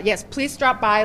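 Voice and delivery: ordinary delivery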